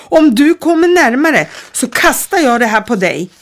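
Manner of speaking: put-on girl holding a glass thing over her head, shouting; threat. A narrator's reading.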